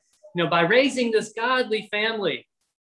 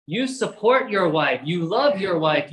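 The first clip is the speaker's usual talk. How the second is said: normal talking voice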